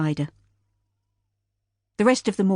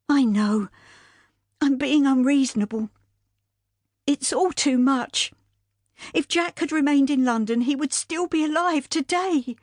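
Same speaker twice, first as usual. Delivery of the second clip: distraught widow; 8 semitones higher than usual. Acted. Widow to Sadie about Jack's death, higher anguished voice. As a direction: let the grief break through.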